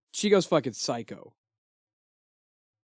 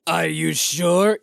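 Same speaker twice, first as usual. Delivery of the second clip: slow, louder metallic alien voice; 5 dB louder, slower than usual. Retold or imitated. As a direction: ask slowly like a metallic alien. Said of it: "this metallic alien voice".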